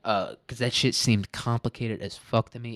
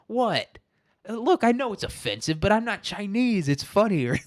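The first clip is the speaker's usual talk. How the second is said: much higher-pitched defensive protest; Ken Marino; 11 semitones higher than usual. Retold or imitated. Brother defending his joke to Paul Rudd, high mock protest. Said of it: "and Ken Marino goes".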